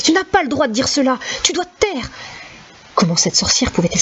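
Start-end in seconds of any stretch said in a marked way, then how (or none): 0.0-2.1 high, pleading yell; desperate yell. Acted. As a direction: beg her to stop, shouting. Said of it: "Hurla Tomek". (supplique criée, aiguë)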